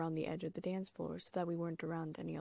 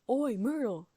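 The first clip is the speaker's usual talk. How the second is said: loud, higher Oi Myrtle; bellow; 6 semitones higher, 9 dB louder, slower than usual. A narrator's reading. Peeves bellowing across the hall to Myrtle, loud. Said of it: "took a deep breath and bellowed".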